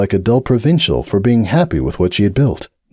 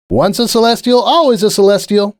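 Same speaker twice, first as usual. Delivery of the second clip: much higher, pointed and knowing; Mercury Dream's voice; 12 semitones higher than usual. Retold or imitated. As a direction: voice the classmate's loaded remark. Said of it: "Mercury Dream had said to him".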